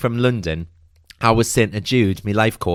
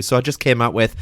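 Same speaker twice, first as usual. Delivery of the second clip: ordinary delivery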